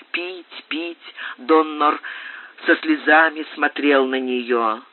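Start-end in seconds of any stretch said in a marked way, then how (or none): none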